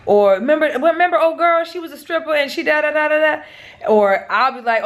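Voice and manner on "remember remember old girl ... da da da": jog her memory in a higher mock voice; mock reminding. Husband objecting to wife's baby name, mock reminding.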